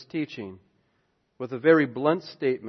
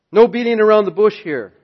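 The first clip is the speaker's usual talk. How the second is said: normal talking voice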